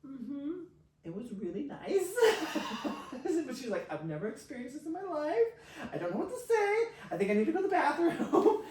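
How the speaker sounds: high, small mock woman's voice, rising; little Russian woman. Quiet woman overwhelmed by a new experience, high little Russian-woman impression. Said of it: "this, like, quiet little Russian woman".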